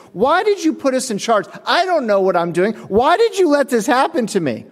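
high, pleading mock whine; upset worker blaming his manager, in a high whine; whiny complaint. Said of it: "It's like".

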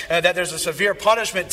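usual speaking voice

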